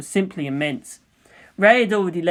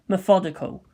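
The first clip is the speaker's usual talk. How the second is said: plain reading voice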